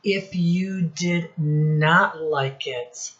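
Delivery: ordinary delivery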